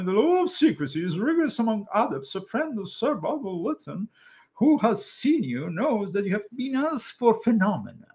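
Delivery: mock old English accent. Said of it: "So that's how they talked".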